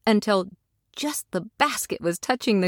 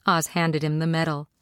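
usual speaking voice

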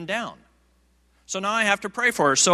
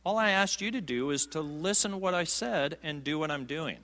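softer, calm reassurance; quiet gentle Lord; 7 dB quieter than usual. Retold or imitated. The Lord quieting the anxious preacher, soft reassurance. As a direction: settle him down gently. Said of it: "he quiets me".

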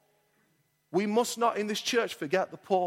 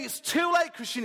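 voicing a despairing listener; 7 semitones higher than usual. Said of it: "You say".

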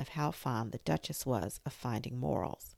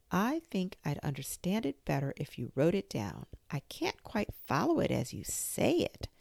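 ordinary delivery, nothing marked

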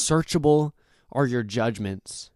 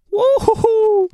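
excited whoop; 10 dB louder, slower than usual. Real, not acted. Delighted at a quoted line.

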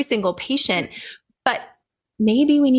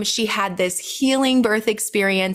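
normal talking voice

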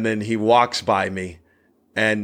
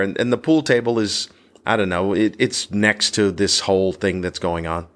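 ordinary talk

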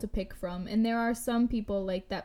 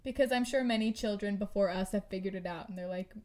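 ordinary delivery

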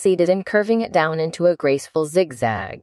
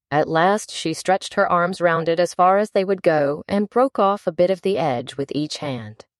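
ordinary delivery, nothing marked

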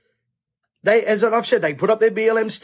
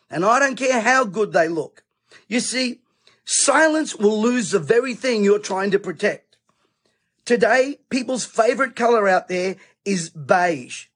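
ordinary talk

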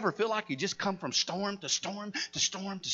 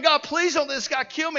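higher, louder mock cry for mercy; retold screaming plea; 9 semitones higher, 9 dB louder than usual. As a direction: imitate her begging God for her life. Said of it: "and she's screaming".

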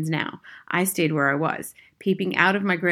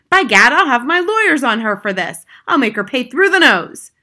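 loud, blustering, raised pitch; angry threat; 6 semitones higher, 10 dB louder than usual. A narrator's reading. Father threatening lawyers on the witch, loud bluster.

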